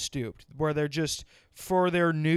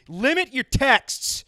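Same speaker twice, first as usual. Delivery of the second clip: louder, slow and higher, laying down the law; angry rant; 6 semitones higher, 5 dB louder, slower than usual. Real, not acted. Ranting at whoever keeps texting, loud and slow. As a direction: snap it out slowly like a final warning.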